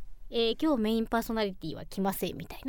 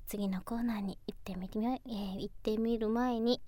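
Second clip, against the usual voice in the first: whispering; 2 semitones lower, 10 dB quieter than usual. Real, not acted. Reluctant host whispers.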